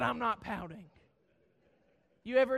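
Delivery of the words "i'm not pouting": low, soft, sulky mutter of denial. Retold or imitated. Husband denying to wife he pouts, low sulky mumble. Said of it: "I said".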